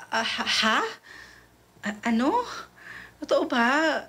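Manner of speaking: high, rising confusion, ha after ha; mock-flustered disbelief; stunned disbelief. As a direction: caught off guard, asking if it's real. Stunned by a confession.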